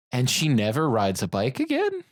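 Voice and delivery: ordinary talk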